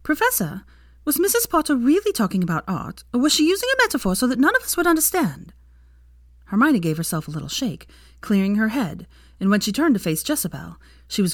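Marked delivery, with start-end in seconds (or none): 0.0-5.5 high, curious schoolgirl voice; a young student asking, unsure; student asks her professor, higher and tentative. A narrator's reading. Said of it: "tentatively asked".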